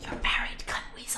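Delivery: unvoiced whispered jab; hushed whispered insult. Real, not acted.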